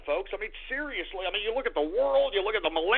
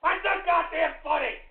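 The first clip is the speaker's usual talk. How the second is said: angry outburst; 7 semitones higher than usual. Acted. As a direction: snap at the joker. Angry at panda picture.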